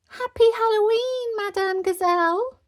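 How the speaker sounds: put-on high, loud children's cheer; group cheer. A narrator's reading. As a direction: shout the greeting like an excited class. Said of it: "everyone cheered".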